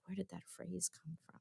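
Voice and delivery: almost under her breath, puzzled; hushed self-talk. Retold or imitated. A new mom murmuring to herself over her baby, very softly. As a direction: murmur it quietly to yourself.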